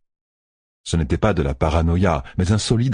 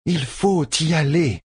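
slow, higher, pressing foreign woman; impatient; 10 semitones higher, slower than usual. Acted. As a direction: press them, losing patience. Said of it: "s'impatienta la Sehanchanienne".

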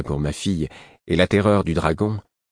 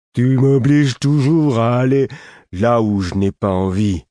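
high, loud, slow complaint; grumpy whine; 7 semitones higher, 6 dB louder than usual. A narrator's reading. Tired Lourd complaining of being made to go, whining. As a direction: drag it out in a put-upon whine. Lourd grumbles while dressing.

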